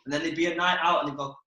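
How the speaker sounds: normal talking voice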